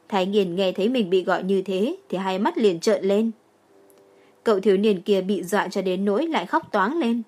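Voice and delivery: ordinary delivery, nothing marked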